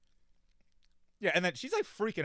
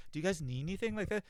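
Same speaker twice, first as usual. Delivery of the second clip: mildly higher, cheery mom offer; upbeat mom; 7 semitones higher than usual. Retold or imitated. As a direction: imitate an upbeat mom lightly. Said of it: "she's so upbeat".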